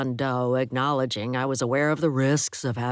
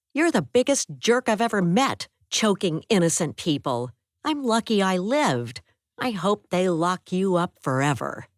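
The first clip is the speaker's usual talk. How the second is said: higher woman's voice, scolding; angry woman; 4 semitones higher, 3 dB louder than usual. Acted. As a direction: voice her higher, hurt and furious.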